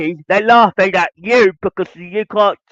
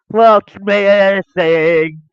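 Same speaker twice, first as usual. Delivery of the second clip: slow emphatic question; much slower than usual. Real, not acted. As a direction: drag the question out so it sinks in. Repeats the rhetorical question, drawn out.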